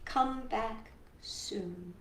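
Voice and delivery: usual speaking voice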